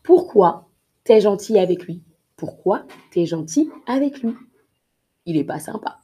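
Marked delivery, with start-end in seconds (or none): 0.0-2.0 louder indignant why; indignant question. Acted. Teacher acting a reproachful friend. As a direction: challenge them, louder and annoyed. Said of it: "acted reproach example".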